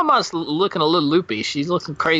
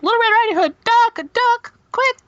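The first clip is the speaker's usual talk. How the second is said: shrill warning; 4 dB louder than usual. Acted. Mom shouting warning.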